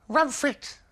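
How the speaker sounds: put-on high toddler echo; baby voice. Baby copying Bill in the park, high and childlike. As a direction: chirp it like a small child.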